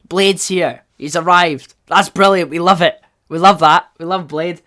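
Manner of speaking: loud, thrilled celebration; real fan excitement. Real, not acted. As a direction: cheer the hero's arrival out loud. Fan thrilled about Blade's arrival.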